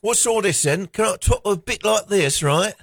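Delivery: high British bobby voice; hammed-up British copper; British accent. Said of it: "in a British accent".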